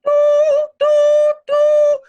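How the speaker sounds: loud, very high trumpeting; trumpet call. Acted. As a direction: blast it like a herald's trumpet.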